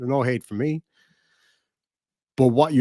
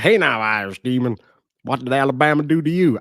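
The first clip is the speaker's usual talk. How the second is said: put-on deliberately poor, hammy Alabama accent; bad Alabama accent; 3 semitones higher, 4 dB louder than usual. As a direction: read it out in a badly faked Alabama accent. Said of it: "This is gonna be the worst Alabama accent".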